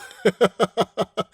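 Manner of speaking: a burst of real laughter; laughing out loud at the line. Real, not acted. Reader laughing at the story's phrase. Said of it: "it's funny as hell".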